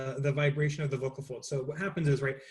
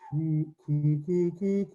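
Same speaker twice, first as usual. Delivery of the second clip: sung coo coo rising higher in the middle; dove cooing; 5 semitones higher, much slower than usual. Shown as a demonstration. Voice teacher demonstrating a dove sound, a sung high coo. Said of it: "we're gonna make a little dove sound".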